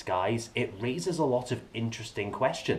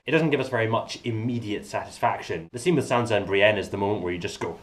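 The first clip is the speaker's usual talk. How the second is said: usual speaking voice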